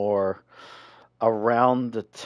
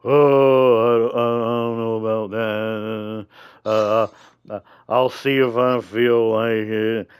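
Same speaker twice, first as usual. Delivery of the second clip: imitating reluctant friend; 5 dB louder than usual. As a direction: impersonate a friend hemming and hawing, loudly. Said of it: "you were like".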